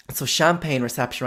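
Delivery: ordinary talk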